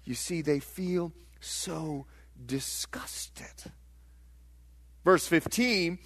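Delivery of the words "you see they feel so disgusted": slow, hushed near-whisper, lower; very soft, drawn-out dismay; disgusted sigh. Acted. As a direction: let it sink into a slow, heavy whisper. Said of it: "It's like this".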